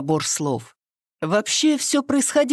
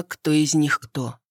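ordinary delivery, nothing marked

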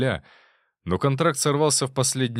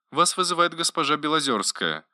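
care robot; 6 semitones higher than usual. A narrator's reading. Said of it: "прозвучал ответ голосового помощника".

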